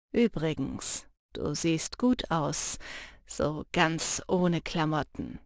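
sly teasing. Acted. Jannis grinning as he teases Lena, low and sly. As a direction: savour the cheeky compliment.

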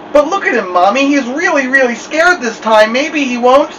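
put-on high, pleading child voice; a small girl begging for her brother; pleading little girl.

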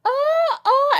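imitating the man yelling as he runs away; retold yelling. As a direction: yell out in a panic.

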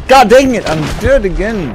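frustrated shout. Real, not acted. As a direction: blurt it out at the screen. Frustrated after dying again.

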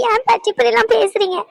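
high-pitched, tearful hurt question; tearful protest. Acted. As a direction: protest as you start to cry. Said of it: "ஏன் அழுவ செய்ற?".

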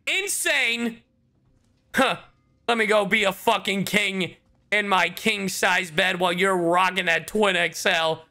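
ordinary talk

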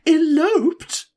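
high, loud, shocked exclamation. A narrator's reading. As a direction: echo the news in shrieking disbelief. Shocked by news of elopement.

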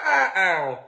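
ordinary delivery

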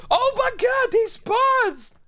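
high, loud yell of frustration; yelling at the spawns; frustrated shout. Real, not acted.

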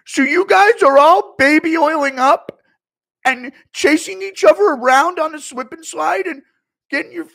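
high, very loud indignant rant; Schmitty rant. Retold or imitated. Streamer as Schmitty scolding partygoers, loud high rant. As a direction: yell it like a wounded ego. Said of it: "Let me tell you this".

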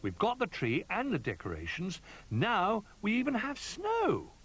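high little bear excitement; delighted. A narrator's reading. Little Bear ready for Christmas, building delight.

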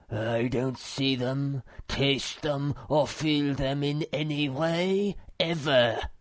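slow, ponderous philosopher voice; solemn muskrat. A narrator's reading. Philosopher disdains cakes before the mother, solemn. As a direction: pronounce it slowly, with solemn weight. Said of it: "twisting his mustache solemnly".

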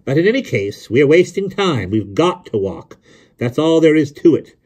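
high, emphatic, no-argument ruling; firm insistence. A narrator's reading. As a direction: shut down the debate, slowing to insist. Insists they must walk.

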